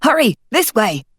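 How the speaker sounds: frantic warning shout to his allies; frantic call. Acted. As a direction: hurry them away in alarm. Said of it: "his eyes frantic".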